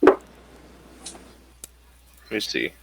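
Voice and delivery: ordinary delivery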